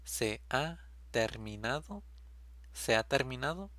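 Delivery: usual speaking voice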